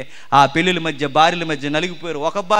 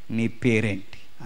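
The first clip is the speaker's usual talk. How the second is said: lower, softer and slow, a solemn question; God asking gently; 6 semitones lower, 7 dB quieter, much slower than usual. Acted. God questioning Jacob, slow solemn quiet question. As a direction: ask it quietly, weighing each word. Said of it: "అప్పుడు దేవుడు అన్నాడు".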